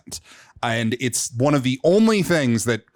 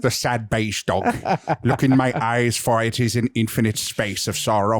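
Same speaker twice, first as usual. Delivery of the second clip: mournful German filmmaker voice; Werner Herzog impression; 3 semitones lower than usual. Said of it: "I just hear Werner Herzog".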